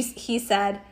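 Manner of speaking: plain reading voice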